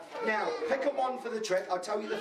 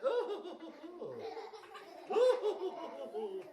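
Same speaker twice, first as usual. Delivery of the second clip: high, repeated comic ohs; a string of high ohs at a surprise; astonished ohs; 9 semitones higher, 3 dB quieter than usual. A narrator's reading. Storyteller as the Lady peering into bag, astonished ohs. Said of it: "She looked in the bag and went".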